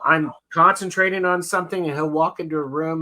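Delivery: ordinary talk, nothing marked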